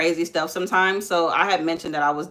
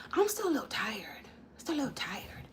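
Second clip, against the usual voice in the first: breathy whisper, barely voiced; whispering tired; 6 semitones higher, 12 dB quieter than usual. Real, not acted. Mom tells viewers she's tired, in a whisper. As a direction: hush it, still tired. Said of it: "I have it quiet today because".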